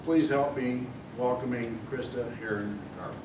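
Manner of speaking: ordinary delivery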